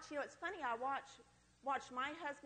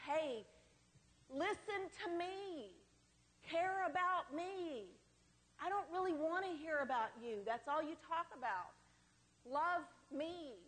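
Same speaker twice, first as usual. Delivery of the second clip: higher, slow pleading to an absent father; 5 semitones higher, slower than usual. Retold or imitated. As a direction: beg him to care about you, drawn out. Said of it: "trying to say".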